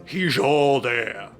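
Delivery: slow, drawn-out parroting; mimicking the player. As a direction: parrot it back slowly. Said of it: "in your voice".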